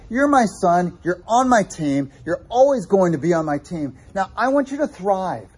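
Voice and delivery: a hint of an earnest father urging his son; God as loving father. Preacher voicing God speaking to his son, earnest. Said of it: "He's like".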